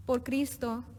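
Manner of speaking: usual speaking voice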